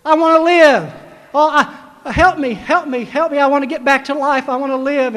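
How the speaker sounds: pleading sobs of a desperate man; pleading cry. Acted. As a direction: beg for life through mock tears. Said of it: "he started crying".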